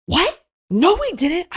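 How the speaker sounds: high, loud mock disbelief; indignant denial. Caught line cutters to the bouncer, mock-shocked denial. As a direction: act shocked at the accusation.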